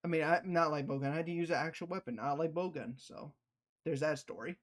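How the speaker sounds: ordinary talk, nothing marked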